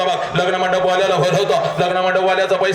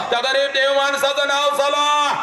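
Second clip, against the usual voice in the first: preaching shout; 8 semitones higher than usual. Real, not acted. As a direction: thunder it out to the crowd. Oratory climax.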